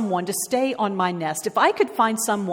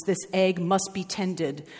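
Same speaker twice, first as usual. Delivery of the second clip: usual speaking voice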